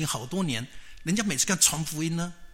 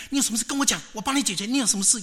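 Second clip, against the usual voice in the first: eager helpfulness; 8 semitones higher, 3 dB louder, faster than usual. Acted. Host offers help to visitor.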